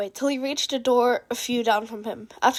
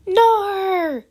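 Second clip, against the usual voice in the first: squeaky exclamation; 8 semitones higher, 4 dB louder than usual. Acted. Mastermind exclaims.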